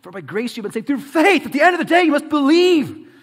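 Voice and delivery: preacher at full force, last words stretched; forceful preaching. Real, not acted. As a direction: drive the point home, rising to a shout.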